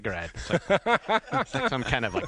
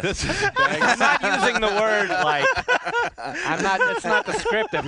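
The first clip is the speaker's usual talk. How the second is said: higher and louder, laughing through the words; laughing protest; 7 dB louder, slower than usual. Real, not acted. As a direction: protest while cracking up. Laughing while defending a word.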